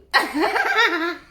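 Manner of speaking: high burst of laughter; loud laugh. Real, not acted. Laughing at her own situation.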